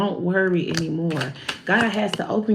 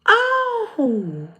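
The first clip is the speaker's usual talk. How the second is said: high, drawn-out oh of realization; dawning surprise; 10 semitones higher than usual. Retold or imitated. Friend grasping the chakra deck, high surprised oh. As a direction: let it dawn on you slowly. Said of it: "he's like".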